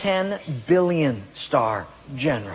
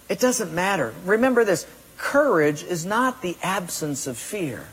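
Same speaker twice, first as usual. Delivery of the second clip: ordinary talk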